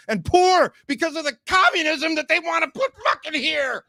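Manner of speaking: hammed-up angry shout; mock furious rant. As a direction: yell it like an outraged passenger. Ranting at the driver.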